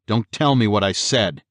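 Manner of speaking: usual speaking voice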